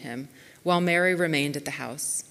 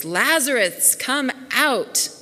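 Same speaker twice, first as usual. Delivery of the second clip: raised, higher commanding call; loud shout; 7 semitones higher, 4 dB louder, slower than usual. A narrator's reading. Jesus calling Lazarus out of the tomb, loud shout. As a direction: call out loudly, pitched well up. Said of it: "Jesus shouted in a loud voice".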